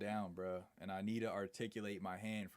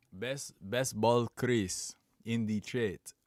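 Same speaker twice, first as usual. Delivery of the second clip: loud, slow reading in a Russian-like accent; booming accidental Russian accent; accidental foreign accent; 8 dB louder, much slower than usual. Real, not acted. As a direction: read it out slowly and loudly with a thick Russian accent. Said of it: "I just read the whole sentence in an accent".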